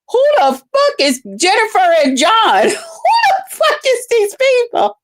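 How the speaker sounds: high, laughing yell of disbelief; incredulous shout. Retold or imitated. Retelling her reply about the fake names, shouted. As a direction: shout it in outrage. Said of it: "I said".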